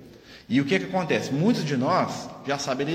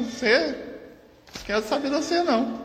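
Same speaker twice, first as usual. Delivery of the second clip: retold rejection; 10 semitones higher than usual. Voices daughter spirit rejecting her.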